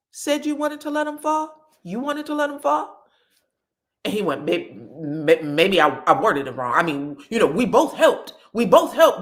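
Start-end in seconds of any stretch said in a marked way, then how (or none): 0.1-3.0 high-pitched indignant questioning, retold; mock incredulous challenge, pitched high; pissed. Wife challenging husband about their son, pissed. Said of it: "immediately she is pissed". 4.4-9.2 stammering, loud, hurried self-defence; flustered backpedalling. Retold or imitated. Husband backing down to his angry wife, flustered backpedalling.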